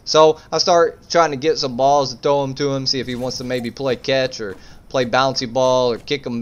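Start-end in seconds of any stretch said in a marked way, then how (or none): none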